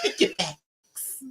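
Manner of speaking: put-on slow, drawn-out New York accent. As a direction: savor each word in New York accent. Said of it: "His thick ass New York accent".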